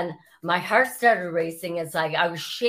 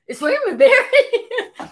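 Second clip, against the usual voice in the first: high, slow, incredulous question through laughter; surprised and laughing, much higher; laughing disbelief; 9 dB louder, slower than usual. Retold or imitated. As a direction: laugh in disbelief at the caller's name.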